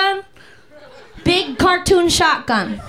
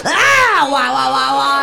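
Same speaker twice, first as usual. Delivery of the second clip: imitated rush. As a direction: act out the hit kicking in. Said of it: "and I go".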